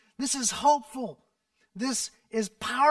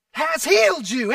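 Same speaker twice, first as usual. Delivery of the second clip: loud, higher proclamation; proclaiming; 5 semitones higher, 8 dB louder than usual. A narrator's reading. Jesus declaring the blind man healed, loud proclamation. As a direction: announce the healing. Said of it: "And Jesus said".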